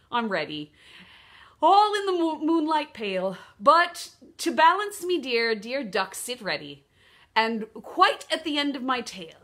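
playful Australian kangaroo, swinging pitch; Australian accent. Acted. Kangaroo telling the duck to sit steady, put-on Australian accent. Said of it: "Sorry about that Australian accent".

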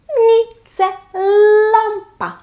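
high, loud playful reveal; mock surprise. Teacher playfully surprised the card is a lamp. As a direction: act surprised for the child.

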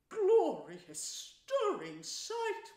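high, loud, drawn-out rapture; enraptured. Acted. Toad marvels at the stirring sight, enraptured. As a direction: savour each word in loud ecstasy.

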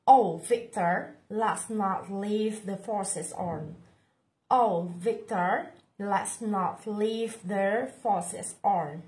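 slow, careful "Oh, Victor, let's not leave the faucets on"; slow model read. Shown as a demonstration. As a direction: read it slowly for learners to repeat. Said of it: "teacher models the dialogue line".

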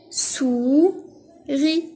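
"souris" in two separate, drawn-out syllables; split into syllables. Shown as a demonstration. Teacher cutting words into syllables, "souris" split in two. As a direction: pause between "sou" and "ris" in "souris". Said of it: "Je découpe les mots en syllabes".

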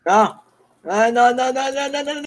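high panicked repeated no; scared horror game streamer. Real, not acted. Surrounded by zombies, panicking.

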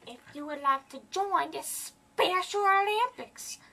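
high put-on caller voice; squeaky prank-call voice. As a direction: pitch up into a silly fake persona.

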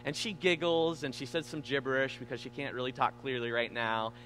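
father gently asks daughter for his book, softly; gentle and calm. Retold or imitated. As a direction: coax the toddler kindly instead of yelling. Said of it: "I didn't get angry".

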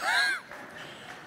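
amused high chuckle; high laugh. Real, not acted. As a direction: laugh at your own joke. Laughs at own joke.

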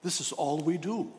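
usual speaking voice

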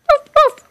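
high, loud little woofs; imitated dog bark. Child voices the pet Meatloaf, high mock barking.

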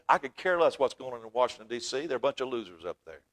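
ordinary talk

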